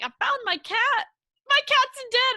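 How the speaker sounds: high, loud, swinging from joy to grief; imitating the owner's joy flipping to grief; emotional roller coaster. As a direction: owner finding his cat dead. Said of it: "roller coaster of emotions".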